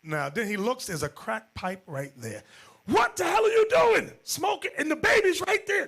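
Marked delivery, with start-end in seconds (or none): 2.8-5.9 high, loud, outraged yell; husband yelling at his wife over the baby; furious shout. Retold or imitated. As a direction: explode at her, much higher and louder. Husband finds crack pipe.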